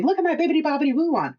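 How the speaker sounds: high, fast, gushing show-off; excited customer. Retold or imitated. Shop customer showing off her payment wand, high and fast. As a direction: rush it out like a proud customer. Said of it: "that's how somebody told me".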